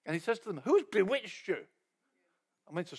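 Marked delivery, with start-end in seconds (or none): 0.6-1.7 louder, sharper accusing question; furious rebuke. Retold or imitated. Paul confronting the Galatian church, angry. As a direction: demand an answer, stung. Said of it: "He writes this furious letter".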